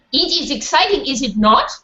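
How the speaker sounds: put-on light French accent, lifted start; Mortimer's French lilt, gently put on. A narrator's reading. French dog to his friend, light French accent. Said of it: "Mortimer said in his French accent".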